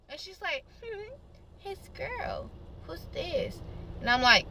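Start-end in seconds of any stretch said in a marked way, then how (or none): 0.8-3.6 high, very soft phone voice; imitated other girl. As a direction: play her small and hushed.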